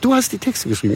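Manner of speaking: high, far-voiced disbelieving question; amazed parent. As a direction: play the stunned mother hearing her son's lyrics. Parents amazed hearing son's demo. (Eltern staunen über den Sohn, hoch und ungläubig gefragt)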